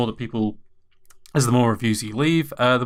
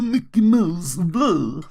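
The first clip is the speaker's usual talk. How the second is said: Mickey vampire voice; 6 semitones higher, 4 dB louder, slower than usual. Retold or imitated.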